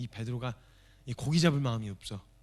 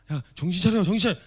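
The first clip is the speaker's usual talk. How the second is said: higher, louder urging; John urging Peter to flee, louder; urgent loud hiss; 5 semitones higher, 9 dB louder than usual. Acted. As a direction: snap a panicking friend out of it.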